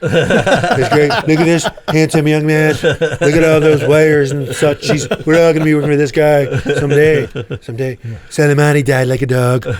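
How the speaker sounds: Trump impression, laughing. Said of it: "he would say".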